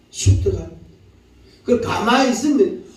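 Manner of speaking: normal talking voice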